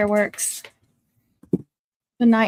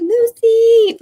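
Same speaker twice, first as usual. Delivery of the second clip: excited call; 12 semitones higher, 10 dB louder than usual. Retold or imitated. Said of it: "Connie said".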